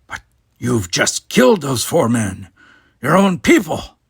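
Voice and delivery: the doctor horrified at the killing of the guards; shocked gasp. Acted. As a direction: gasp it out in horror. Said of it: "Then McCoy gasped".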